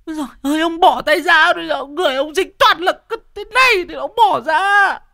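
very high, loud wailing protest; wailing wife. Acted. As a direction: struggle free from a filthy husband, crying out. Vợ giằng co với chồng. (vợ kêu la giằng co)

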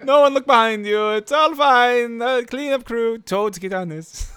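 high, slow mock Italian announcer; hammy Mario calming everyone; Italian accent. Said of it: "Mario slash Italian accent".

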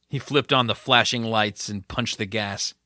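ordinary delivery

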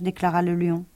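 plain reading voice

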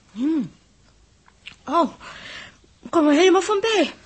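luid en hoog van genot; verrukt. Acted. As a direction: geniet hoorbaar van elke hap. Meisje proeft heerlijk eten. (meisje proeft eten, luid en hoog verrukt)

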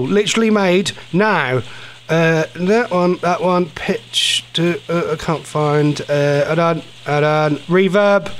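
ordinary talk, nothing marked

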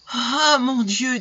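high, slow wail of dismay; lamenting. A narrator's reading. Mother dismayed by her ungrateful daughter, lamenting. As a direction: exclaim in despair, drawn out.

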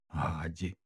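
soft, hushed near-whisper; hushed, questioning lift at the end. A narrator's reading. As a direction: say it very low under your breath. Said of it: "прошепотіла вона".

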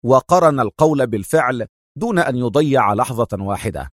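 ordinary delivery

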